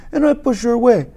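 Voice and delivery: ordinary delivery, nothing marked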